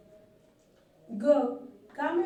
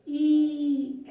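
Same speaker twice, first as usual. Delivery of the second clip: "ई" pushed out hard and loud with force; loud long ee; 2 semitones higher, 11 dB louder than usual. Shown as a demonstration. Teacher shows the long ee to children, shouting it with force. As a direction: press hard on a loud "ई", mouth wide open. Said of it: "जोर से बोलना पड़ेगा".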